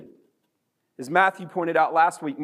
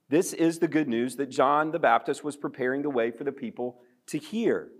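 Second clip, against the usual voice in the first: ordinary delivery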